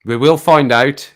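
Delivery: loud, slow, portentous vow; mock-dramatic declaration. Streamer teasing a clue to viewers, loud mock-dramatic.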